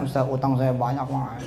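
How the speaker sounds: low, soft, fading mock plea; mock dreary complaint. Preacher mimics a debtor's dull plea to Ustaz, dreary. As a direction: imitate a feeble debtor, sinking as you go. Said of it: "Nggak seru ngomongnya".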